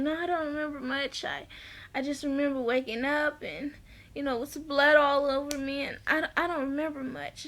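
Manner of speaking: soft, hushed retold quote; lightly acted, quiet recollection; subdued recounting. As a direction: softly play a dazed patient. Voices injured woman in hospital.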